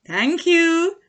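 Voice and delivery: squeaky high thanks; high little fox. A narrator's reading.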